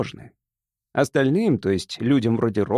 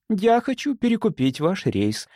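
higher-voiced, composed woman; calm answer; 7 semitones higher than usual. A narrator's reading. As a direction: state it evenly, with mild assurance. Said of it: "спокойно ответила она". (спокойный женский ответ)

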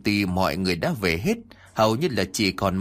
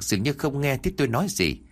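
usual speaking voice

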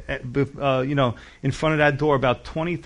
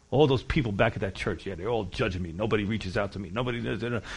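much softer, lower, sulky self-pity; backslider complaining; 6 semitones lower, 8 dB quieter than usual. Pretend. A backslidden believer blaming the church, low and softly sulking. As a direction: mutter like a hurt backslider.

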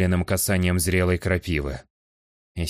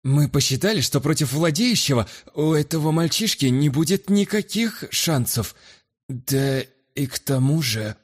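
higher, uneasy excuses; nervous guest voice; 8 semitones higher than usual. Acted. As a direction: justify yourself nervously. Guest's character voice.

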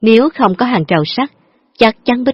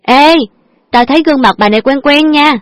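higher, surprised call-out; sudden exclaim; 7 semitones higher, 3 dB louder than usual. Acted. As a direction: blurt it out in recognition. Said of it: "vụt kêu lên".

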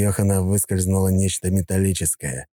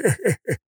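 short sneering chuckle; high gloating laugh; 14 semitones higher than usual. A narrator's reading. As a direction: laugh at his pain. Nika laughs.

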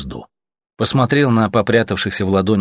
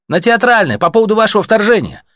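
loud and higher, calling across the distance; 13 semitones higher, 6 dB louder than usual. Acted. Messenger calling to a blinding checkpoint, loud. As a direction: project it to a far-off post.

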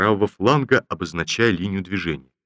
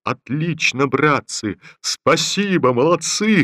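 hearty general's praise, rising higher; praising; 7 semitones higher, slower than usual. Acted. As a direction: cheer the troops on like a pleased general. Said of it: "хвалил генерал".

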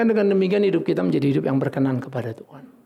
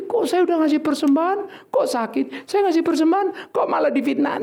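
higher, aggrieved whine of a churchgoer; whiny complainer; 9 semitones higher than usual. Pretend. Preacher mimics a congregant whining about suffering after giving. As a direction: plead like a wronged giver.

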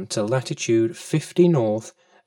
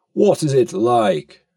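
a big, high, louder whale asking eagerly; 5 semitones higher, 6 dB louder than usual. Acted. The hungry whale asking the fish eagerly, big voice. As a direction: lift it up and push it out. Whale asks about man.

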